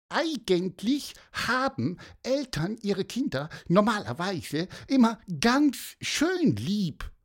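high squeaky explanation; earnest little bird. A narrator's reading. Pieper adds that parents love children, high earnest chirp. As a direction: explain it brightly in a tiny high voice.

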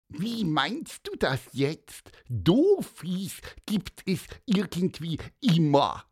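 high, piping bird voice; high squeaky bird question; little bird voice. Acted. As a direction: ask it like a puzzled little bird. Bird Piper asks back.

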